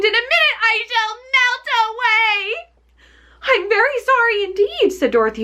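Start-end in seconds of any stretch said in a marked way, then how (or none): 0.0-2.5 high witch voice in terror; panicked witch. Acted. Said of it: "she screamed". 3.4-4.9 put-on high, startled little girl apologizing; a scared girl blurting an apology; frightened Dorothy. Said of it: "truly frightened".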